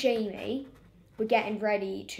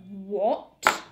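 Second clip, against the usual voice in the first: mock fuming; 6 dB louder than usual.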